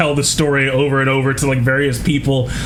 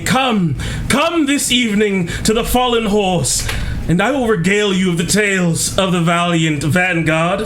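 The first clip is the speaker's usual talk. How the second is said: high, booming invitation to the crowd; announcer voice; 6 semitones higher than usual. Acted. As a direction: project it like a town crier. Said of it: "project as any good, uh, person of my stature would".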